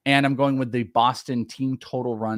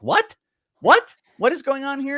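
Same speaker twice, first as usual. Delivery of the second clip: much higher, incredulous repeated What; mock disbelief; 5 dB louder than usual. Dad hamming up his shock at his wife's request. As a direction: squeal out the What in playful shock. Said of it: "And I'm like".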